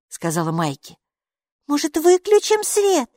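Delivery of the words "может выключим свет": put-on very high child voice; little girl's suggestion. A narrator's reading. Maiki proposes a way to find the elf, high child voice. Small girl makes a suggestion.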